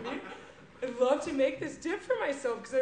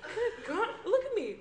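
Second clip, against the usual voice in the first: dip-obsessed party guest; 3 semitones lower than usual. Acted. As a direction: mumble happily about the dip. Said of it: "this first character".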